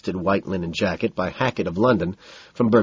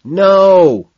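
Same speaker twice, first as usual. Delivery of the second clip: high, loud, long denial; drawn-out holler; shout; 9 semitones higher, 9 dB louder than usual. A narrator's reading. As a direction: yell a correction over music. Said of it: "Madison shouts".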